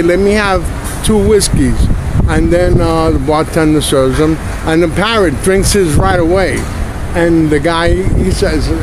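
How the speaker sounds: loud, hammed-up joke teller; punchy comic joke delivery; joke telling. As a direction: belt out the gag like a stand-up. Announces and tells a joke.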